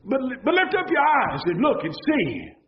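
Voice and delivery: rising cry to lift their eyes; full-force preacher's cry; preaching shout. Real, not acted. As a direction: call out to the whole congregation. Exhorting congregation, shout heard.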